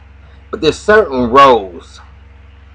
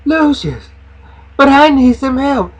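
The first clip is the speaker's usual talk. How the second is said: much higher pleading voice; nanny's pleading; 13 semitones higher than usual. Retold or imitated. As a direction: beg him in a high, small voice. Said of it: "So she said".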